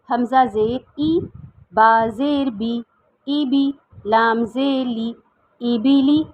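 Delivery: very slow, syllable by syllable; slow spelling. Shown as a demonstration. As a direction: spell it out slowly for beginners. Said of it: "جوڑ کر کے بتاؤں گی". (آہستہ آہستہ ہجے)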